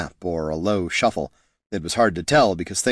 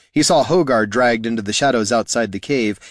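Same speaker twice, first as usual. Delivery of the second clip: usual speaking voice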